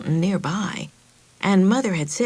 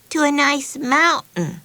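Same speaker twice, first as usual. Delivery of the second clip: correcting; 8 semitones higher than usual. Acted. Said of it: "Betsy corrected her".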